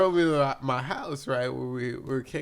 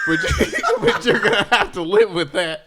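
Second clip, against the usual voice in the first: loud, high, breaking into laughter; amused, raised-voice imitation; laughing punchline; 8 semitones higher, 9 dB louder than usual. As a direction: retell the comeback while cracking up. Punchline of retold confrontation.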